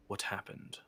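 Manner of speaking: restrained, quiet interrogation; lightly voiced wary questioner. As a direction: question them with quiet suspicion. Game master voices the questioner.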